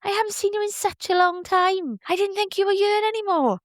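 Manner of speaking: overjoyed to see her nan again; joyful disbelief. Retold or imitated. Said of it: "And I went".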